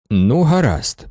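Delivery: ordinary delivery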